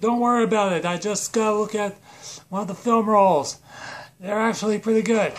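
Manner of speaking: put-on villain, high and hurried; Space Voldemort. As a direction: call out a brush-off from the back room. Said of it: "Space Voldemort's like".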